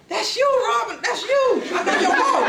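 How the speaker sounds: gleefully accusing him while cracking up; laughing girlfriend. Retold or imitated. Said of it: "this girl said".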